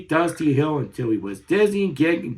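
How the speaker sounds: ordinary delivery, nothing marked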